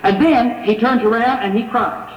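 ordinary delivery

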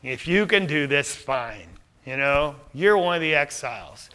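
quietly condescending brush-off; arrogant. Acted. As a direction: grant it grudgingly, unimpressed. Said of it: "there's a tone of arrogance".

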